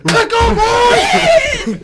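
loud high-pitched hype scream; hype yell. Real, not acted. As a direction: scream it like a frat bro. Hyped podcast intro yell.

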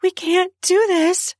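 high, panting reluctance; high-pitched, out of breath; breathless protest. A narrator's reading. Breathless protest in love scene.

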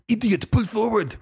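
high put-on voice yelling idiot; mock snapping. The guy in the car yells at Clouseau, high mock snap. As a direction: bark it in a silly high voice. Partner voiced in retold scene.

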